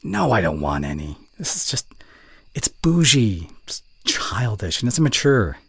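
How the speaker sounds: sharp, disgusted scolding of a friend. Acted. Scolding a friend for sipping soda, disgusted. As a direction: scold her like a disappointed parent.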